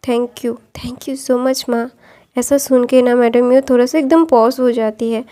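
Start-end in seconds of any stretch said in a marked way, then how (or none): 0.1-1.9 slow, breathless whisper; whispered thanks. Acted. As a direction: whisper it softly into her arms. Crying son thanks his mother.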